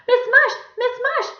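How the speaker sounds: put-on high, loud child calling out; urgent cry for help. A narrator's reading. Allison calling to the teacher through the door, urgent. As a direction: yell desperately in a little girl's voice.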